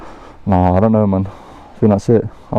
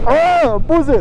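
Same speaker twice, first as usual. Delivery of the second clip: excited squeal; 13 semitones higher, much slower than usual. Real, not acted. Thrilled by the view.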